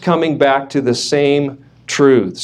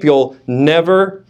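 ordinary talk, nothing marked

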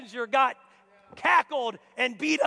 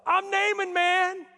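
higher, louder indignant boast; offended Naaman; 6 semitones higher, 4 dB louder than usual. Retold or imitated. Naaman protesting the messenger, loud and indignant. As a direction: puff up like a wounded big shot. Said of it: "He got offended".

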